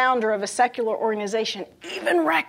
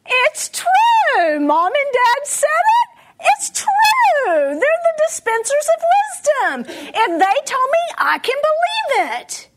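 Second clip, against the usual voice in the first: put-on much higher, wide-eyed little kid believing every word; small child voice; 12 semitones higher, 4 dB louder, slower than usual. A small child insisting to the audience that parents are always right, in a high little voice. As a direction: play a trusting young child, pitched well up. Young kids trusting their parents.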